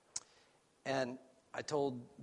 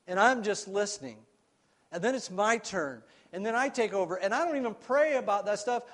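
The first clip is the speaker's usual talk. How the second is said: rising, louder preacher's testimony; preaching; 5 semitones higher, 8 dB louder than usual. Acted. Pastor to church, testifying with mounting fervour. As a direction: build the confession with fervour.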